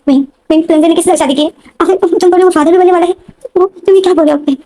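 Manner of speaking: rising high, pressing questions in disbelief; shocked pleading. Retold or imitated. Sister pressing Wing about the marriage, high shocked pleading. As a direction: beg for the truth, stunned.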